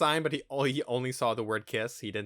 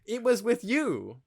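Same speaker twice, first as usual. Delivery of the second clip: high-pitched, drawn-out incredulous protest; exasperated retort; 10 semitones higher, 4 dB louder, much slower than usual. Acted. Podcaster answering a hypocritical film character, exasperated. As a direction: stretch it out in exasperated disbelief.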